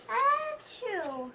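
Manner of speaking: high loud achoo; acted sneeze. Gerald sneezes in front of Doctor Cat. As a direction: sneeze big.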